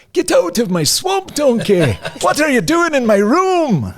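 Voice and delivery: high, loud ogre shout; hammed-up Shrek bellow. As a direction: kids yelling like Shrek at home. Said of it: "periodically you hear things like".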